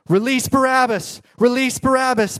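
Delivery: the mob shouting to Pilate, loud and high; crowd shouting. Retold or imitated. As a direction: chant it like an angry mob. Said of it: "They shout".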